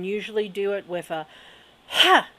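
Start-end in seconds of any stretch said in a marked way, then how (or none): 1.9-2.3 a sudden loud high burst of ha; sharp release ha. Shown as a demonstration. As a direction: blast the ha out to release the energy. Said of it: "to release it and send it out".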